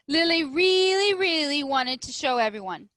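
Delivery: storyteller pushing up the excitement; eager. A narrator's reading. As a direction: read it like she can't hold it in. Lily's growing eagerness.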